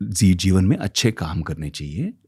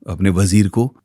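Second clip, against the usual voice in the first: usual speaking voice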